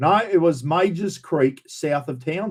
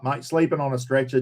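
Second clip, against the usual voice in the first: normal talking voice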